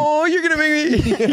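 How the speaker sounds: high mock girl voice; man doing a woman's voice. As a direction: squeal it like the girl in bed. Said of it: "you talk like you're the girl".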